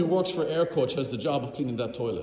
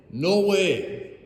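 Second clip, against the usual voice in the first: strong refusal; 4 semitones higher, 4 dB louder than usual. Retold or imitated. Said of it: "uses the strongest language possible".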